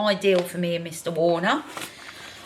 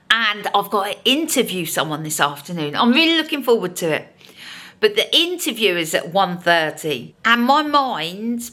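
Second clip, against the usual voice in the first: normal talking voice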